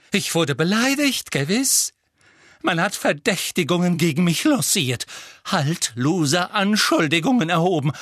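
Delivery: high, loud, wounded outrage; trembling with agitation. A narrator's reading. Trelawney tells the class she was insulted, high and trembling with agitation. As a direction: insist all is well while plainly furious. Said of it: "ihre Stimme bebte vor Erregung".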